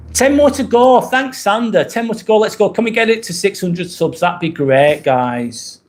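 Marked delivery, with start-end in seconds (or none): 0.1-4.2 excited countdown. Real, not acted. Counting down to a subscriber goal.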